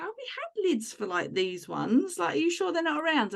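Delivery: retold husband's worried objection; doubtful protest. Husband objects to binning lids, retold.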